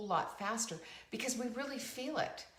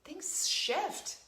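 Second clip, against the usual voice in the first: hushed, breathy whisper, pitched up; 8 semitones higher than usual. Shown as a demonstration. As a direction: lean in and whisper it like a secret. Sudden whisper for emphasis.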